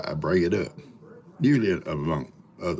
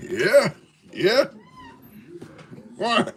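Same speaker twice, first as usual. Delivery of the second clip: voicing himself; much slower than usual. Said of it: "comes home with the wife".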